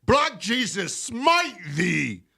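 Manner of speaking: mock biblical prophet. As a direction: thunder like a hammy prophet. Mock preaching, loud high.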